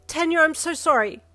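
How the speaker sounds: high loud yell of "sorry"; yelled apology. A narrator's reading. Yin yelling her apology at Ida, high and loud. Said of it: "you yelled at him".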